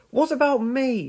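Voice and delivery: high, indignant little engine; high-pitched put-out engine; Thomas. A narrator's reading. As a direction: protest in a high voice at being left out. Thomas protests at being left out.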